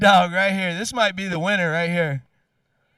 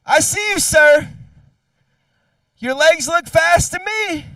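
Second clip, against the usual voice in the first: calling out; 5 semitones higher, 5 dB louder than usual. Real, not acted. Calling out a kid at the track.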